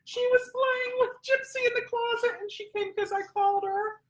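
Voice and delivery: little squeal, still in the puppy-calling voice; squeaky puppy talk. Real, not acted. Trainer cooing after calling her puppy, high and squeaky.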